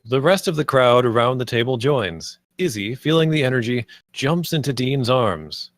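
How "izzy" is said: ordinary talk, nothing marked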